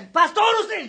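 very high, loud outburst; frantic excitement. Retold or imitated. Overjoyed father jumps before the pastor, frantic shouting. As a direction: burst in, beside yourself.

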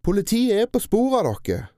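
high, loud defiance; defiant. A narrator's reading. Tina defiantly threatening the kidnappers with the police. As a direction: throw the threat in their faces. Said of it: "sa Tina".

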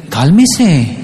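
condescending calm-down, lightly acted; telling dad to calm down. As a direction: play the kid calming dad down. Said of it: "en realidad me está diciendo es".

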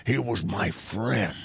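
low, slow mock-earnest quote; movie character. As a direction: imitate a film character, slowed right down. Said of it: "Yeah, it's like".

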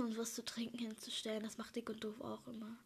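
hushed whisper. Real, not acted. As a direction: drop to a whisper. Whispered aside mid chat.

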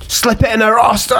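high, excited mock shout; retold yell. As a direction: blurt it out high, imitating a friend. Retells friend shouting into message.

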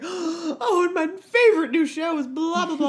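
high, loud breathless gush over a new show; hammed-up rave, squealing with delight; gushing future fan. Future self raving about her new favorite show, gushing. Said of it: "I will be like".

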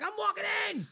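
high, loud, stubborn declaration; defiant insistence. Retold or imitated. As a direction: refuse to be wheeled in. Defiant patient quoting himself.

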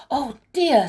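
high, loud little outburst of dismay; exclaim, caught out by the time; dismayed exclamation. A narrator's reading. Molly hears the bus is due, dismayed.

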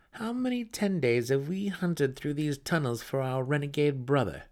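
dry, weary jab at his sister; sarcastic brother. Acted. Brother sarcastically asks his sister how long they've hunted. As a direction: needle her with mock boredom. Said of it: "revealed his sarcasm".

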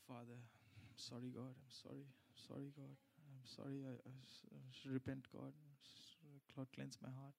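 very soft, low mock-penitent murmur; contrite prayer. Churchgoer confessing Saturday's wrong to God, hushed and contrite. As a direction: act out a hushed, halting plea for forgiveness.

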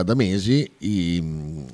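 ordinary talk

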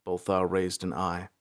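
plain reading voice

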